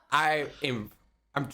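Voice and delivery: ordinary talk, nothing marked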